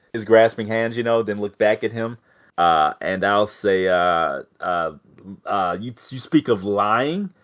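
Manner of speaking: ordinary talk, nothing marked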